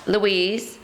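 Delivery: normal talking voice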